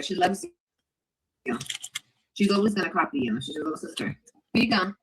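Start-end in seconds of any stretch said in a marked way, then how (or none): 3.4-4.2 whispered aside, softer. Shown as a demonstration. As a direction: lean in and whisper it under the breath.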